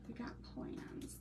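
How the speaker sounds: secretive whisper; whispered aside. Shown as a demonstration. Sharing crafting plans with viewers in a secretive whisper. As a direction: breathe it as a little secret. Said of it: "We got plans with these".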